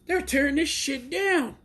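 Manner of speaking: high and slow with awe; amazed. Real, not acted. Fan telling viewers the match amazed him, high and slow. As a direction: marvel at how good the match is.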